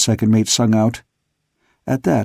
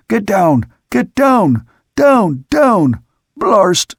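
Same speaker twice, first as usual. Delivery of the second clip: desperate shout; 8 semitones higher, 4 dB louder, much slower than usual. A narrator's reading. Someone shouting to go down.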